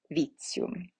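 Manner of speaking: "Vitium" softly, as veet-see-um; TI said as tsee. Shown as a demonstration. Teacher repeating the TI example, "Vitium" with tsee. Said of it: "it is pronounced si".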